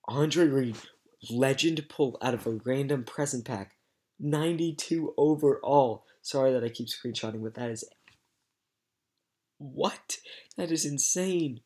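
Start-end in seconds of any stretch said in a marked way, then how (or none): 0.1-6.0 excited. Real, not acted. Announcing huge pull. 9.6-11.6 stunned amazement. Real, not acted. Reacting to pull.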